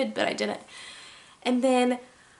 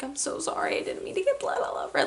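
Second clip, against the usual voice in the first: high, breathy, hurried pleading; panicked apology, retold; 4 semitones higher, faster than usual. To mom about the bloodied dress, breathy panicked apology. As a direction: imitate your panicked self apologizing, voice thinning to whisper. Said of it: "Like, I'm panicking".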